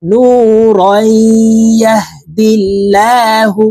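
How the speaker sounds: নূরান merged nasally into ইয়াহদিল্লাহু; ghunnah. Shown as a demonstration. Teacher showing idgham, নূরান blending nasally into ইয়াহদিল্লাহু. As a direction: hold a nasal hum linking নূরান to the following y. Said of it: "এখানে গুন্নাহ করে পড়বো".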